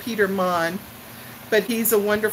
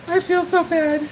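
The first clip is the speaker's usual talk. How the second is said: real crying laugh, pitched much higher; laughing through tears; 8 semitones higher than usual. Real, not acted. As her dog comes to comfort her, laughing through tears. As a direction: laugh and cry at once, higher. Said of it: "she doesn't like me to cry".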